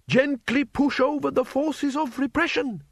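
meek chorus of comrades, slowly. A narrator's reading. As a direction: recite it together like a timid slogan. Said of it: "they chorused".

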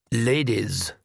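lightly voiced courtly vampire; suave vampire. A narrator's reading. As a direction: greet them with formal, menacing politeness. Vampire greets the witches.

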